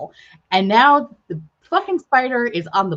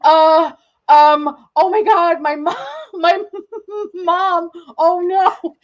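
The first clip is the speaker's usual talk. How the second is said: panicked; 6 semitones higher, slower than usual. Retold or imitated. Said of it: "and I'm like".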